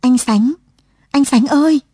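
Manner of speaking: high, loud cry of a name across a crowd; calling shout. A narrator's reading. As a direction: shout out to him, pitch rising on ơi. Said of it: "Lụa hét lên". (tiếng gọi thất thanh, cao và to)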